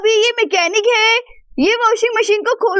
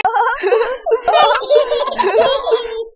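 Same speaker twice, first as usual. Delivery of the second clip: group laughter; all burst out laughing together; everyone laughing; 2 semitones higher than usual. Acted. Family laughing at the mouse in the washing machine. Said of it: "सभी जोर जोर से हंसने लगते हैं".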